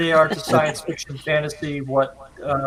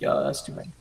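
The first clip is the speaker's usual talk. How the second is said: muttered aside; 7 dB quieter, much faster than usual. Retold or imitated. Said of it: "It's like".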